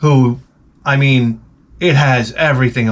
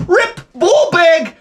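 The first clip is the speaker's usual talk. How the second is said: much higher, slow squealed blurts; high mock tic outbursts, pretending to have them; 13 semitones higher, slower than usual. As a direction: squeal out fake tics. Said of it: "I want Tourette's".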